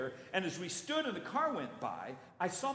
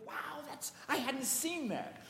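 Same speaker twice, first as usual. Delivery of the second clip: higher, softer, slow amazement; hushed wonder; 9 semitones higher, 5 dB quieter, slower than usual. Pretend. Churchgoer spots the hidden logo, hushed wonder. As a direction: breathe it out in wonder. Awed discovery.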